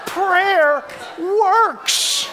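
high, emphatic preacher's proclamation; full-force preaching. Real, not acted. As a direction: drive the conviction home to the congregation.

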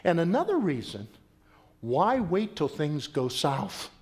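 usual speaking voice

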